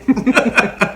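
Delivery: high, loud laugh; burst of laughter. Real, not acted. Laughing at a quote from the movie, high and loud. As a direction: cracks up laughing.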